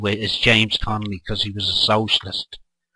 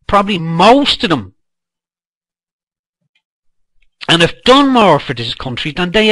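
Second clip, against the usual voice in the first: higher, louder, heated tirade; furious rant; 8 semitones higher, 4 dB louder than usual. Real, not acted. Speaker defending his past to critics, furious. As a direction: let the anger boil over, near shouting.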